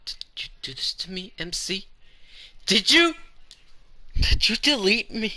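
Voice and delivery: shrieking, very high and loud accusation; Monika screaming. Acted. A betrayed Monika screams at MC, high shrieking accusation.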